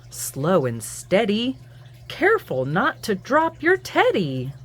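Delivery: lively sing-song picture-book read-aloud; reading to children. A narrator's reading. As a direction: read it to little ones at bedtime.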